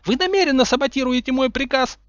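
high-pitched furious yell. A narrator's reading. Umbridge accusing Snape of sabotage, yelling. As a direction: yell it, losing all composure. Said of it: "заорала Амбридж".

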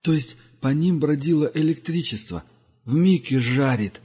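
lightly voiced wise old pilgrim; Duga's voice. Duga explains. (голос мудрого странника)

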